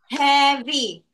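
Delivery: "heavy" slowly in two separate beats; syllable by syllable. Shown as a demonstration. Teacher asking pupil how many claps, "heavy" slowly split in two. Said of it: "how many claps is that".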